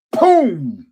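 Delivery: mock impact sound. Imitating the hit across the face.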